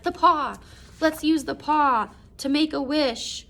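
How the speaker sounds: high, slow, desperate urging; frantic plea. Retold or imitated. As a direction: beg with wild hope. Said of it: "she's crying and she's laughing".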